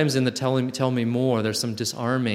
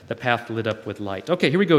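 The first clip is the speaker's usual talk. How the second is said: normal talking voice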